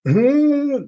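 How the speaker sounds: high loud nerd cry; Khan yell with inhaler. Retold or imitated. Nerd Trekkie using his inhaler, high loud yell. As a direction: yell it between inhaler puffs. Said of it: "take out his inhaler and go".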